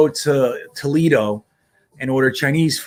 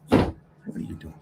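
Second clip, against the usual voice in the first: slow whisper of a repeated question; 6 dB quieter than usual. Real, not acted. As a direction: whisper it again, drawn out. Repeats it to the kid.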